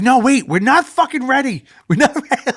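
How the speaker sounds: high, frantic mock protest; panicked refusal. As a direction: yelp it in a panic, half laughing. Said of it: "And I was like".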